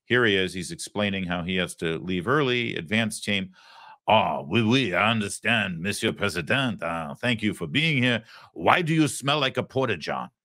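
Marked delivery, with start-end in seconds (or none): 4.1-10.3 hammed-up, lilting French accent; English with a badly faked French accent. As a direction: do a terrible mock Frenchman. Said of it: "this is the worst French accent".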